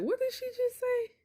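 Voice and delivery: high, squeaky mock disbelief; stunned reaction. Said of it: "you'll be like".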